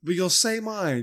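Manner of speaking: loud, slow indignant retort. Real, not acted. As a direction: call out the unfairness.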